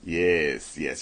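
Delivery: usual speaking voice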